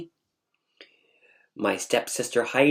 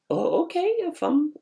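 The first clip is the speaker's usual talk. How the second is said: pitched-up, hesitant okay; high squeaky okay. Retold or imitated. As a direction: squeak out a doubtful yes.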